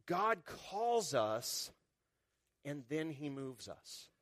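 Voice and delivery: slow, deliberate and fading; preacher slowing for a key point; slow emphasis. Real, not acted. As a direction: lay each word down slowly. Slowed sermon emphasis.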